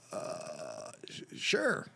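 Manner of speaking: unsure, reluctant-sounding yes; hesitant agreement. Retold or imitated. Put on the spot, hesitant answer.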